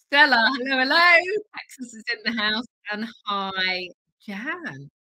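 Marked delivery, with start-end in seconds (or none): none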